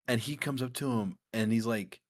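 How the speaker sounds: ordinary talk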